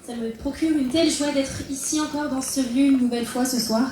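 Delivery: normal talking voice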